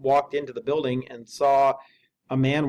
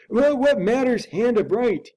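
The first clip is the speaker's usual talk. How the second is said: higher, slow changed voice for the servant; servant's voice, higher and slower; another voice; 10 semitones higher, 6 dB louder, slower than usual. A narrator's reading. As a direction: shift into a different, dragging servant voice. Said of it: "with another voice".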